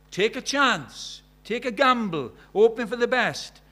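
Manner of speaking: loud challenge. Acted. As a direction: preach it like a warning. Preacher challenging congregation.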